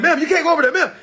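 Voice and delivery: mock cop barking at her. Officer stopping his girlfriend.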